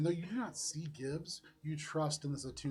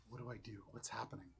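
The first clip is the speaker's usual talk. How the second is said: near-whispered inner questions; panicked thoughts, hushed; 4 semitones lower, 11 dB quieter, faster than usual. Acted. Game master voicing overheard thoughts amid the fire, hushed. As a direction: whisper it low, as private thought. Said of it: "listen to the thoughts of Elise Fukumoto".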